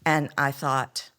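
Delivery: ordinary talk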